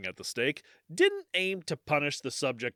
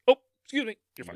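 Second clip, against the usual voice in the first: much higher, louder chirpy Midwestern excuse me; Midwestern politeness; 10 semitones higher, 6 dB louder, much faster than usual. Retold or imitated. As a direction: chirp it brightly to a stranger you bumped. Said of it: "That, that's how it is".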